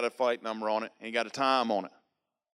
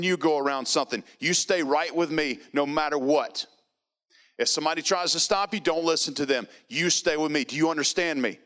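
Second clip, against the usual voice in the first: higher, louder commanding voice; urgent military command; Marine orders; 4 semitones higher, 3 dB louder than usual. Retold or imitated. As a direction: bark firm instructions like a Marine. Marine giving firm orders.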